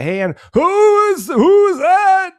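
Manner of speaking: put-on high, loud, cranky old-man yell; loud high old-man holler that drags at the end. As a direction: shout it like a confused grumpy grandpa. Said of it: "he's just old ... yells at everybody".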